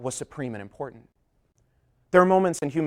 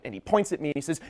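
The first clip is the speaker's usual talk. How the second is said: ordinary talk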